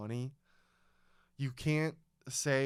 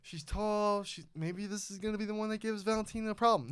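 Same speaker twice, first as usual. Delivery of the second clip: higher, fast musing tone; hopeful inner voice; 8 semitones higher than usual. Retold or imitated. Fan recalling a fight pick, hopeful musing. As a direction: talk yourself into a bad pick. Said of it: "I'm like".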